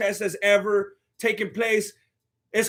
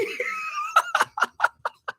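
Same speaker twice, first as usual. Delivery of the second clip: high cackling laugh; 3 dB quieter than usual. Real, not acted. Streamer cracking up at the shirt joke, high cackle. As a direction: cackle hard at your own joke.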